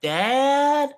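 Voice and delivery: high calling into an empty house; Kevin McAllister calling out. Retold or imitated. Friend imitating Kevin calling for his family, high and loud. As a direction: call out for family, high and echoing. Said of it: "like when Kevin McAllister wakes up".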